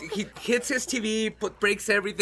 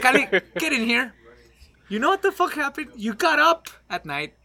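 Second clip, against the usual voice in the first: louder, higher, laughing as it builds; triumphant detective reveal; 6 semitones higher, 10 dB louder than usual. Retold or imitated. Solving the case to roommate Cali, laughing triumphant reveal. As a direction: announce the solution, cracking up. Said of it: "do this whole Sherlock thing".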